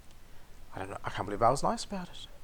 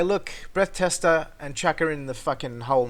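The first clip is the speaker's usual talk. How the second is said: demanding; 4 semitones higher, 6 dB louder than usual. Retold or imitated. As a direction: spell it out for the cops. Imagined demand to police.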